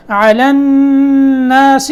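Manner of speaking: slow, drawn-out tajweed chant; Quran recitation. Shown as a demonstration. Teacher reciting for students, slow melodic tajweed. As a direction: recite it slowly with ghunna. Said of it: "یہاں نون کے اوپر کیا ہے".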